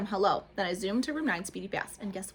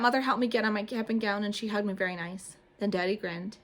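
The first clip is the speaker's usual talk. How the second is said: plain reading voice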